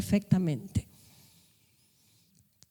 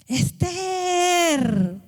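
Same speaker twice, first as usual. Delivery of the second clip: crying out a name; 6 semitones higher, much slower than usual. Retold or imitated. Imagined Mardoqueo calling out to Esther, mild mock shout. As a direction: call out to her like a worried elder. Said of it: "Mardoqueo pegando gritos".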